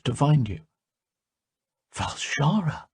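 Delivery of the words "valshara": soft breathy whisper of a name; barely voiced, weak and waking. A narrator's reading. Giui whispering his guide's name on waking. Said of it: "whispered Giui".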